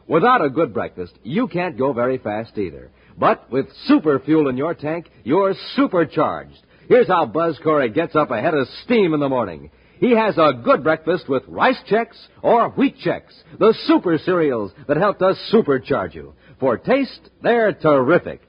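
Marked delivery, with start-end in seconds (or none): none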